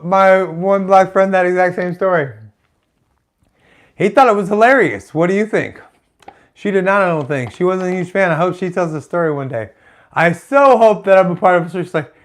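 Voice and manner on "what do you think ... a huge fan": ordinary delivery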